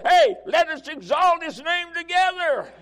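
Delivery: very high, loud shouted praise, slow; ecstatic preaching. Real, not acted. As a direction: burst into joyful shouting.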